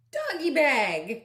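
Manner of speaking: ordinary delivery, nothing marked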